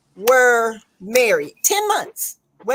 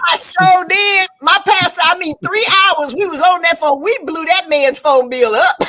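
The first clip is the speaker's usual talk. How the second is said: proud shout; 5 semitones higher, 5 dB louder than usual. Real, not acted. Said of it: "running up that man phone bill".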